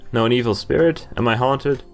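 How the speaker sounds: ordinary talk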